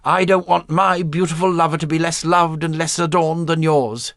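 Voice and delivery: put-on eager young woman. A narrator's reading. Blanche speaks of her lover.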